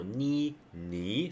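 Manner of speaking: 你 held with a rising tone; second tone. Shown as a demonstration. Teacher isolating the changed syllable, rising second tone on 你. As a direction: lift 你 upward instead of dipping it. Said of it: "the second one".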